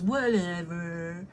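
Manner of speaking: "Whatever" stretched slowly, rising at the end; dismissive drawn-out whatever. Retold or imitated. Gym guy brushing her off, slow rising sneering whatever. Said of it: "Anyways, he goes".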